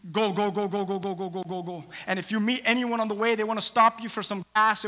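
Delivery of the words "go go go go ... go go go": rapid-fire repeated command, tailing softer; quick chant of urging, fading away; urgent hurrying. Acted. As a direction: rattle it off to send someone running. Acting prophet urgently sending servant off.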